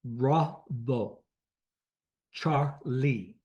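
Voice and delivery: Bravo and Charlie in separate, stressed syllables; radio-alphabet Bravo, Charlie, syllable by syllable; phonetic alphabet demo. Shown as a demonstration. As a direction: sound out Bravo and Charlie, first syllable leaned on. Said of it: "shows you how you pronounce it".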